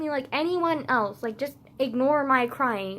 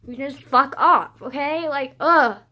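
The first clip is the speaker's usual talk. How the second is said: angry; 8 dB louder, slower than usual. Real, not acted. Telling him to back off.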